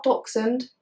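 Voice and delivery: "Dachshund" said as DOK-sund; wrong pronunciation. Shown as a demonstration. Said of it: "not Dachshund".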